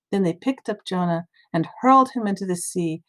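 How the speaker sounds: usual speaking voice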